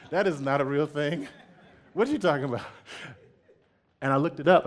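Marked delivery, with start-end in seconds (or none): 0.1-2.7 mock outrage still laughing, higher; laughing disbelief. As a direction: tease through laughter, pitched up.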